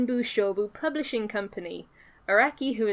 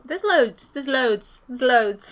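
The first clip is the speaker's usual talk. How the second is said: emphatic insistence; 4 semitones higher, 5 dB louder, much slower than usual. Real, not acted. Insisting there are many shows.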